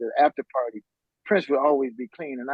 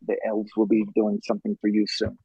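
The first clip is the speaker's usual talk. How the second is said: low, hushed prophecy; 8 semitones lower than usual. Retold or imitated. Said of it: "Prince was always with some esoteric".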